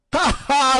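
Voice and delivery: high, loud witch's cackle; old hag cackling at evil. A narrator's reading. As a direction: cackle with glee. Hag laughs again.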